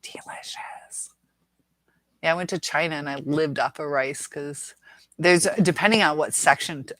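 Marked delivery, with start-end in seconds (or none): 0.0-1.1 whispered delight. Real, not acted. Savoring rice in a whisper.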